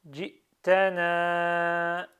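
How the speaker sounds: "Jitana" very slow and loud, final a held long; prolonged ending. Shown as a demonstration. Teacher shows the ending, "Jitana" with long last vowel. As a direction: draw out the end of "Jitana". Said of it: "Je prononce à la fin".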